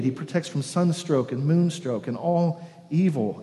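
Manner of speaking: usual speaking voice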